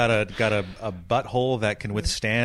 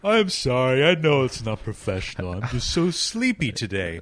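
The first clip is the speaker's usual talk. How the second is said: sleepy bear voice; 3 semitones higher, 4 dB louder than usual. Pretend. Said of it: "you sound like a cartoon bear".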